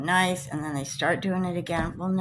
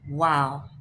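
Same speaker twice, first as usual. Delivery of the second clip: usual speaking voice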